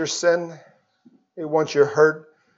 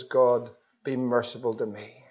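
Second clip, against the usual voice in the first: humble hushed plea; 5 semitones lower, 6 dB quieter than usual. Retold or imitated. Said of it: "fell on his knees, humbled himself".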